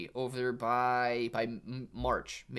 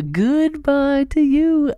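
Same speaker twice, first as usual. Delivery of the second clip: higher still, loud and slow; a bigger, louder taunting goodbye; sing-song goodbye; 12 semitones higher, 15 dB louder, slower than usual. Pretend. Taunting the bear market, higher and louder sing-song goodbye.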